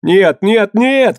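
frantic cried-out denial; desperate cry. A narrator's reading. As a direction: deny it in rising panic. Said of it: "кричал Квинт".

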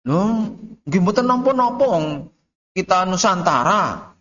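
high, lightly voiced objecting student in Javanese; a hint of a cheeky pupil protesting; mock stubborn student. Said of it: "Kok ngeyel jenengan?".

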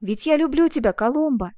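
loud, ardent declaration of love; joyful bold exclamation. A narrator's reading. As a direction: proclaim love in a surge of joy. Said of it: "обретая в приливе радости ту смелость". (громко, радостно и смело)

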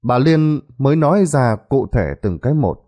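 plain reading voice

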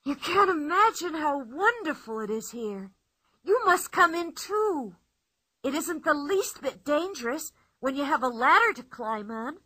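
put-on boy thrilled by his hiding place; Tommy's voice. A narrator's reading. Tommy speaking from inside tree.

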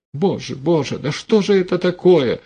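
high-pitched, tearful lament; distraught plea. A narrator's reading. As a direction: plead high, close to tears. Struck, tears in her throat.

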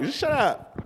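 high-pitched, incredulous shut-up; comic high-pitched exasperation; exasperated dismissal. Acted. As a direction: dismiss them in a squeaky high voice. Same telling-off repeated.

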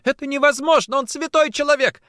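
very high, loud, fervent protest; crying out. A narrator's reading. Woman defends accused man, crying out. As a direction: cry it out in disbelief. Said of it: "вскричала Варя".

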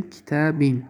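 کتابین without al-, falling on -bin; tanween kasra ending. Shown as a demonstration. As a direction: end کتابین in a clear -in. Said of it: "اگر ال شو بگیریم". (کتابین بدون ال، با تنوین کسره در آخر)

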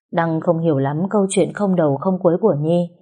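ordinary delivery

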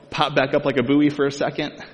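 usual speaking voice

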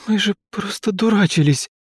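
put-on high, tearful sobbing girl; crying out the words; through tears. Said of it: "сквозь поток слёз проговорила она". (сквозь поток слёз)

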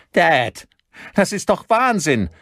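high, loud, distraught objection; high-pitched agitated cry; frantic protest. A narrator's reading. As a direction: protest in alarm to your father. Son protests to father.

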